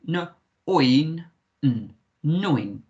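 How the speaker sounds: "No, oin, n" in pieces, then "Neun"; "Neun" built slowly, rhyming with coin; oi sound. Shown as a demonstration. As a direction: break up and blend "Neun" with an oi vowel. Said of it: "make the oi sound".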